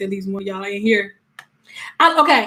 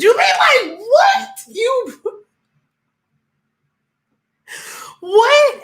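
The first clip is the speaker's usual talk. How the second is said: real stunned squeal of disbelief; shocked disbelief; 12 semitones higher, slower than usual. Real, not acted. Shocked reaction to a comment.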